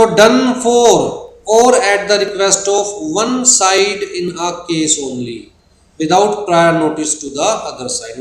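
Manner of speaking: slow, word by word definition read; dictating a definition at a crawl; slow dictation. Shown as a demonstration. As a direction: spell it out slowly for note-taking. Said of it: "dictating definition to students".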